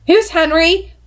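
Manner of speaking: demanding. A narrator's reading. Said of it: "Tessa demanded".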